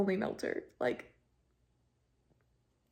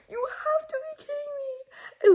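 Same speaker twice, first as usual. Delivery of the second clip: much higher, crying disbelief; teary disbelief. Real, not acted. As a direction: squeal it out, can't believe it. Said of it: "I was like".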